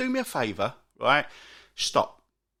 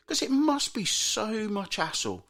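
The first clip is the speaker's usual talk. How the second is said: usual speaking voice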